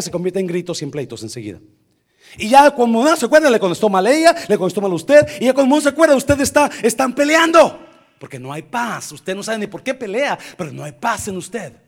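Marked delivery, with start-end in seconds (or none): 2.4-7.8 loud, high sermon build-up; forceful, piled-up phrases from the pulpit; heated preaching. Real, not acted. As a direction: preach the quarrel as it escalates.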